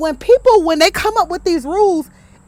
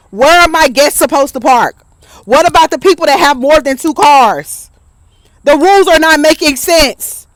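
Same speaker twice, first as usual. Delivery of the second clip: shouting the complaints out loud; loud rant; 7 dB louder than usual. Real, not acted. Fed-up homeowner yelling about the HOA rules, loud. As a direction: vent it at full volume, fed up. Ranting repeat of her complaints.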